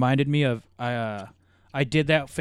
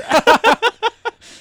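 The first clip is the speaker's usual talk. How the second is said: burst of laughter; 10 dB louder than usual. Real, not acted. Boss insists he's serious.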